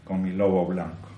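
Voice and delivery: ordinary delivery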